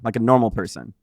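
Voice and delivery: normal talking voice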